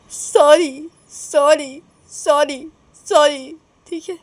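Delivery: pleading apology. Acted. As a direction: apologise over and over, each sorry separate. Neha apologises with folded hands. (बार-बार गिड़गिड़ाकर माफ़ी)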